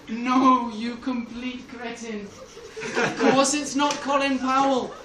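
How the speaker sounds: loud, high, hammed-up exasperation; exasperated. Bush corrects his foolish senator, exasperated. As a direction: cry out in mock despair, stretching the words. Despairing Bush berates Helms.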